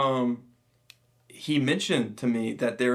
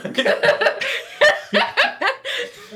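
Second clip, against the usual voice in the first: a long squealing laugh, much higher; high shrieking laugh; 5 dB louder than usual. Real, not acted. Host cracking up at a nerdy bird-call complaint, shrieking laugh. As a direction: cackle hard at the absurd tweet.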